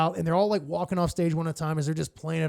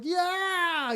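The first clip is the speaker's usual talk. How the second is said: crowd cheer; 12 semitones higher than usual. Retold or imitated. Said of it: "the crowd's like".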